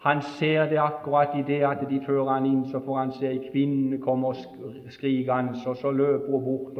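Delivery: ordinary talk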